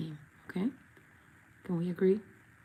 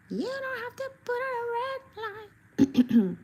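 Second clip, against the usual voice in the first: high, slow squeaky teasing voice; silly high voice. Pretend. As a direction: tease her in a squeaky cartoon voice. Playful high voice to viewer.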